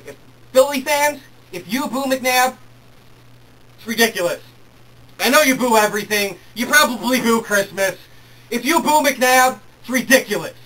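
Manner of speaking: indignant lecture to the fans; scolding. Real, not acted. Scolding Philly fans over booing.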